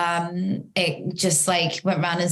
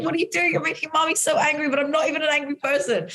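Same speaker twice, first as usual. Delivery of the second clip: high, fast exasperated mom voice; a frustrated mother losing it at her son; retold angry outburst; 9 semitones higher, much faster than usual. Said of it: "So then I got so angry".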